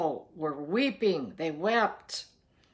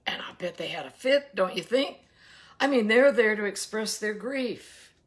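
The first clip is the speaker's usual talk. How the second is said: ordinary talk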